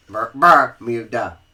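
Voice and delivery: loud, slow Southern "Bermuda" with "Ber" as "Bar"; Southern accent on "Bermuda". Shown as a demonstration. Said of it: "I know we're from the South".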